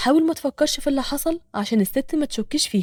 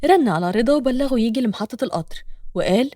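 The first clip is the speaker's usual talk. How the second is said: plain reading voice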